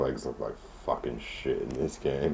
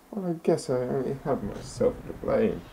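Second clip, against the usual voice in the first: put-on a higher old lady voice; resigned old lady; 11 semitones higher than usual. Landlady accepting eviction, somewhat higher. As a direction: sigh it out as the granny.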